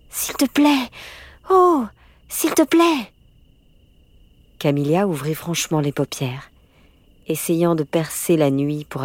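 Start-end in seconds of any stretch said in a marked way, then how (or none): 0.1-3.1 high, urgent begging; pleading. A narrator's reading. Girl begs her sleepy friend for help at night, pleading high. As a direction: plead in a much higher, louder little voice.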